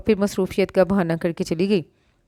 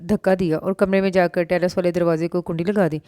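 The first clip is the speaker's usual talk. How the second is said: ordinary delivery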